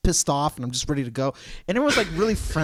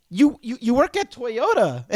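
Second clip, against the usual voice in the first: mock incredulous; 9 semitones higher, 4 dB louder than usual. Retorting to young salesman.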